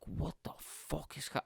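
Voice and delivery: breathy whispered what-the-fuck; hushed stunned mutter; whispered disbelief. Retold or imitated. Retold reaction to loud music.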